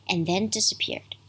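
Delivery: ordinary delivery, nothing marked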